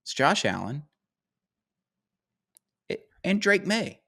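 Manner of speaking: high, excited mock Bill Simmons voice; imitating Bill Simmons. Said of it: "He's like".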